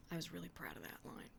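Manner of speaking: quick whispered joke to the listener; fast conspiratorial whisper; whispered aside. Real, not acted. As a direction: slip in a fast hushed aside. Whispered aside while reading.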